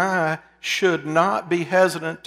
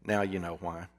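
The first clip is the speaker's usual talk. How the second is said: quiet reply; 8 semitones lower, 9 dB quieter than usual. A narrator's reading. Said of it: "replied quietly".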